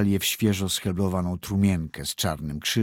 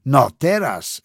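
healer speaking up; 4 semitones higher, 7 dB louder than usual. A narrator's reading. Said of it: "rzekła znachorka".

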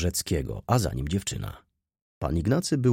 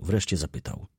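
ordinary delivery, nothing marked

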